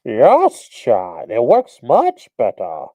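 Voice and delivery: loud, slow Bane voice in a cup. Shown as a demonstration. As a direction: boom it through a cup like Bane. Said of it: "I like to do the Bane voice by talking to a cup".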